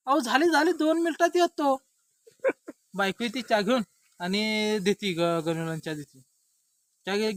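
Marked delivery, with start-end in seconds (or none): 0.1-1.7 high, loud answering call; calling back. Acted. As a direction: shout back from the next room. Wife calls back from kitchen. (वरच्या आवाजात ओरडून उत्तर)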